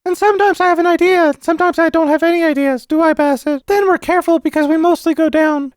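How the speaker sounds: put-on very high, loud child voice; eager little boy. Boy telling uncle about his winnings, high-pitched and eager. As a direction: pipe up as a keen small boy.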